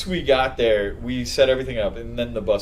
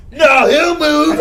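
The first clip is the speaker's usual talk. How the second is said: high, loud, drawn-out yell imitating the lady; acted-out shouting match; retold scream; 10 semitones higher, 8 dB louder, slower than usual. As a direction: holler back stubbornly. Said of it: "We're just screaming at each other".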